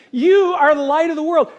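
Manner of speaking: loud, high declaration as Jesus; emphatic proclamation. Retold or imitated. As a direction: proclaim it to the whole crowd. Repeats Jesus' words emphatically.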